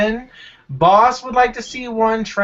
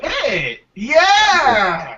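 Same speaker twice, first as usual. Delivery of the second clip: excited cheer; 6 semitones higher, 4 dB louder than usual. Real, not acted. Excited cheer at Pitt choice.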